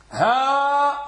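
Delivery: ها held long and slow; lengthened vowel. Shown as a demonstration. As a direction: draw out the aa of ها. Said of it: "المد".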